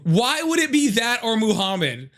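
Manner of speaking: hammed-up disbelief; mock indignation. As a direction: act offended at the question. Mock outrage at his own joke.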